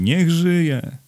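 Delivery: high, ringing hurrah; greeting cheer. A narrator's reading. Onlookers greet him at the hotel, high cheering cry. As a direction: cry out a welcome with pitch leaping up. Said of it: "powitały okrzyki".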